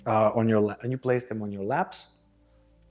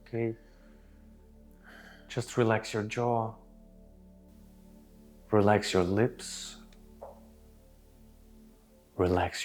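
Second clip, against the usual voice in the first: soothing massage murmur. Acted. Guides relaxation.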